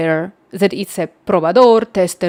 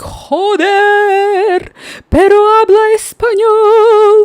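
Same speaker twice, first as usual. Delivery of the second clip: very high, loud, drawn-out Spanish wail; exasperated mock shriek; imitated screaming; 13 semitones higher, 7 dB louder, much slower than usual. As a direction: mimic the shouting girl, stretching the words out. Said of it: "screaming and shouting on me".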